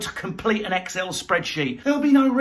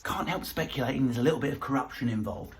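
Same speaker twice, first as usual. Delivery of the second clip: quick aside; 5 semitones lower, 7 dB quieter, faster than usual. Acted.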